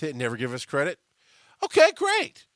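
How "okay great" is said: high, loud sing-song okay great; mock unbothered. Telling idea thieves he doesn't care, mock sing-song. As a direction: shrug it off in a bright mocking lilt.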